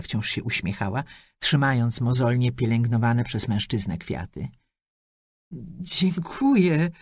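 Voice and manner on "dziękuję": "Dziękuję" stumbled out very slowly; halting, slow "Dziękuję"; stammered thanks. A narrator's reading. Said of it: "wydukała Joanna".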